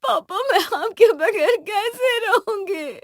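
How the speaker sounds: high, loud, slow crying; wailing. Acted. Bride asks father about life without him, wailing in tears. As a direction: sob it out, stretching the words.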